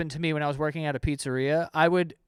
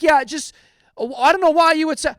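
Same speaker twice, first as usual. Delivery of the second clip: raised, louder whiny customer; mock customer voice; 8 semitones higher, 7 dB louder than usual. Retelling the pizza caller, mock whiny complaint. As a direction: imitate the annoyed caller, higher.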